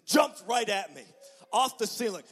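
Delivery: sudden excited outburst; startled telling. Retold or imitated. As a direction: jolt as it leaps at you. Spider leaps at him.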